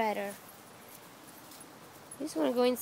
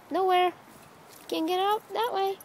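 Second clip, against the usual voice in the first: ordinary talk, nothing marked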